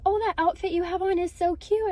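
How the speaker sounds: high gushing stranger's praise, mildly imitated; a hint of an enthusiastic lady; gushing compliment. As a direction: play a stranger lightly gushing over the outfit. Stranger compliments her outfit.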